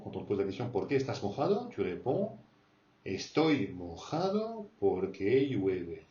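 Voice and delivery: usual speaking voice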